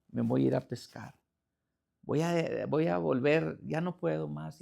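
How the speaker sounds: soft, low, resigned prayer; retold weary surrender, low and subdued; despairing. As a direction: give up quietly to God. Retells despairing prayer to God.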